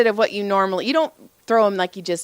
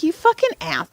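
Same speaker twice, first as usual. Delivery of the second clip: pitched-up frustrated curse; high exasperated insult; 10 semitones higher than usual. Retold or imitated. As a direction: spit it out, annoyed. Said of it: "I was like".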